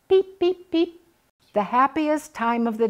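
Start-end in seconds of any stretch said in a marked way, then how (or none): 0.1-1.0 high, cheery birdie chirps; little bird chirp; canary chirping. A narrator's reading. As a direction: peep it out like a tiny canary. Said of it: "sits in his cage all day long and chirps".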